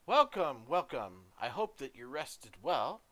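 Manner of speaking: a gracious dwarf queen, warm welcome. Acted. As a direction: greet the guests broadly. GM voices the smiling queen.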